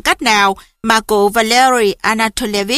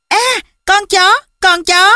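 put-on high-pitched child's cheer; high, drawn-out squeal of joy; overjoyed cry; 9 semitones higher, slower than usual. A narrator's reading. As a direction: cry out like a thrilled little boy. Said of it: "Nó mừng rỡ reo to".

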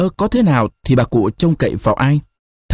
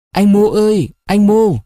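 timid calling at night; 8 semitones higher than usual. A narrator's reading. Girl calling her lover outside the door, timid high call. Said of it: "những tiếng gọi rụt rè".

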